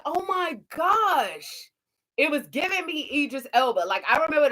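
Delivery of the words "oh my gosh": a slow, drawn-out, high oh my gosh; amazed. Retold or imitated. Reviewer hearing the actress's real accent, slow amazed gasp. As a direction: savor the surprise slowly. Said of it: "I was like".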